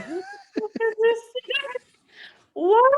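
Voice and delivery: incredulous amused outrage; laughing disbelief. Retold or imitated. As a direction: sergeant laughing at a stranger at parade rest. Confronting stranger in hallway.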